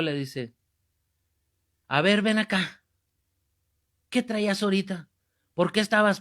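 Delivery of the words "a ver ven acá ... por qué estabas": higher, firm questioning; annoyed priest, acted. Priest confronts the man who distracted people, acted annoyance. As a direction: act out a priest calling someone to account. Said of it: "el padre ya lo quería agarrar".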